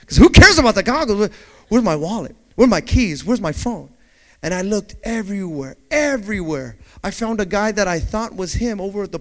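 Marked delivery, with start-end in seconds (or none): none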